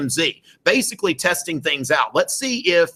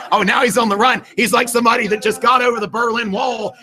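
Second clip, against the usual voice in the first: excited commentary; 8 semitones higher, 4 dB louder than usual. Real, not acted. Mock commentary on student leaving.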